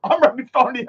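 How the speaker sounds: a laughing parody of a scandalised judge; mock outraged judge. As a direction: ham up an offended judge. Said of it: "And the court goes".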